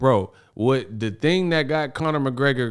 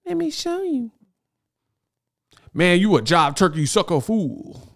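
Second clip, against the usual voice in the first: much higher put-on jive-talking street hustler voice; seventies blaxploitation hustler. Host mocking the boxer's slang as a blaxploitation film, high hammed-up hustler voice. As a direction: ham up a seventies movie pimp, high and cocky. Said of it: "they talk like this, like blaxploitation films from the seventies".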